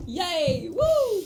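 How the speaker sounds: high, loud whoop of joy; excited cheer. Real, not acted. Cheering patch release.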